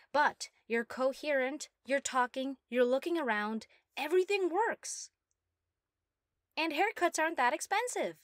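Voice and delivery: giddy, triumphant gushing; thrilled by her own success; excited. Acted. Gloating over her working creation.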